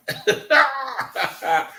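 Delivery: short high chuckle; high laugh. Real, not acted. Streamer chuckling again over his mic light, high laugh.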